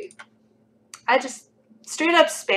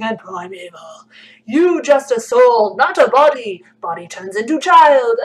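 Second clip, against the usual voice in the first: louder, slow, solemn mystic voice; 3 semitones higher, 8 dB louder than usual. Pretend. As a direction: intone it like a mystic sage. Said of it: "like, mystic voice".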